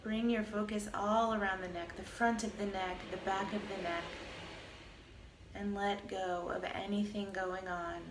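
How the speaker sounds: calm, even meditation voice with long pauses; guided meditation. Acted. Teacher guiding listeners through a body scan, calm meditation voice. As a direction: lead a body scan, unhurried, leaving space between phrases.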